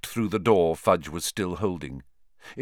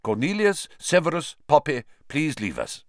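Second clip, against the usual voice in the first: put-on firm old wizard; 6 semitones higher than usual. Dumbledore dismissing them.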